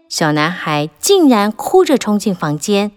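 ordinary delivery, nothing marked

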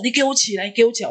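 very high, repeated mock-angry command; fierce father. Father ordering the child lying on the floor to get up, fierce and high. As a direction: imitate a father nagging crossly, pitch way up. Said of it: "他很凶".

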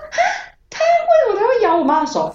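high, half-laughing, half-whining disbelief; shocked disbelief. Retold or imitated. Answering the classmate on the dream phone, high disbelief. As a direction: squeal out the question in disbelief.

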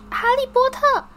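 an excited house-elf squeals the name; high shrill elf voice. A narrator's reading. House-elf greets Harry, shrill and very high. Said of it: "那个生物的嗓门又高又尖".

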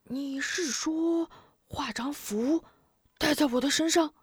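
half grasping the idea; puzzled. A narrator's reading. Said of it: "似懂非懂". (似懂非懂地问)

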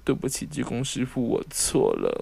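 grovel to the master with a comic sink; exaggerated sheepish sorry; mock contrite apology.

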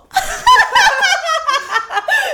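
high, loud cackling laugh; big burst of laughter. Real, not acted. Laughing hard at the boyfriend's reply. Punchline about boyfriend wanting breakup.

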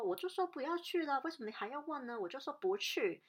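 mock snap at a housemate; imitating a snappy roommate. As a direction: throw back her irritated refusal. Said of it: "然后她竟然凶我".

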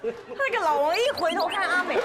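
telling listeners the ghost story's turning point, with rising suspense; dramatic retelling. Shown as a demonstration. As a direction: lean into the scary moment. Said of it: "结果这时候老王一回头看他".

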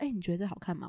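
soft, low near-mumble; imitating a quiet voice. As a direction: ask so quietly it barely carries. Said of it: "大家会觉得听不到".

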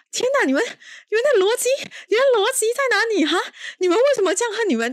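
high, loud, rapid-fire ranting; exasperated outburst. Real, not acted. Fed-up reviewer ranting at the villain couple, high and fast. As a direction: throw up your hands at their logic.

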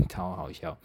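breathy laughing aside. Real, not acted. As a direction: burst of laughter on the words. Laughing at own mishap.

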